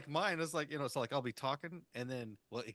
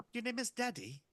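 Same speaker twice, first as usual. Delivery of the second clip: high-pitched put-on assistant voice; voice assistant impression; 11 semitones higher than usual. Siri naming its owner, high put-on assistant voice. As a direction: mimic Siri answering, pitched well up. Voicing Siri's reply to owner.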